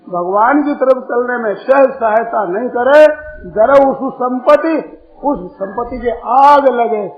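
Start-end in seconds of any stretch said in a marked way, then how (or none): none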